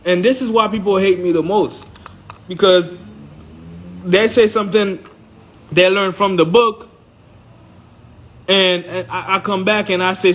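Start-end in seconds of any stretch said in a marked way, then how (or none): none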